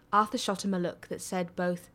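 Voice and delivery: usual speaking voice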